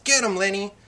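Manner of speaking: narrated urging shout that carries; yelling. A narrator's reading. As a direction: yell it as if through a cupped hand. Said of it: "cupped his hand around his mouth and yelled".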